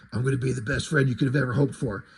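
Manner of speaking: put-on reading Batman's promise to the kid, fast and low; fast low Batman growl. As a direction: rattle it off low and gruff. First reading of the line.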